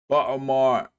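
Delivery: put-on rough try at a Baltimore accent. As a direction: say Baltimore the local way, Bawlmer. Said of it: "I need to work on my Baltimore accent".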